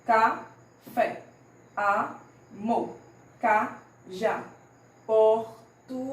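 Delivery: "Café, amor, cajá, português" one syllable at a time; syllables separated. Shown as a demonstration. Teacher shows pupils syllable separation, syllable by syllable. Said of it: "a gente separa as sílabas".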